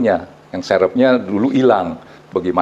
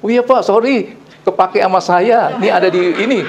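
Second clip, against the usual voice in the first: imitated helper's high-pitched call; retold calling out; 8 semitones higher than usual. Helper telling the boss she had the keys, imitated high call. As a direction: call out a sheepish admission in a high mock voice. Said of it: "A R T saya teriak".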